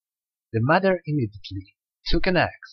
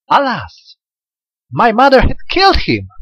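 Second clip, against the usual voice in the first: much higher, louder anguished cry; crying out in grief; 10 semitones higher, 7 dB louder than usual. A narrator's reading. Daughter discovering her husband's head in the water: anguished cry. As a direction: wail the discovery aloud. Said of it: "she cried".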